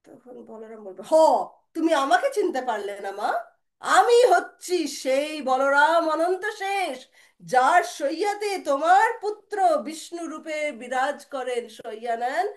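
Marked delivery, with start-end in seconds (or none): 1.1-12.4 loud, slow, weighty proclamation; grand self-revelation. Acted. Balaram revealing himself to mother, loud slow majestic declaration. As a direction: declare the divine name, stretching each phrase. Boy reveals his divine identity.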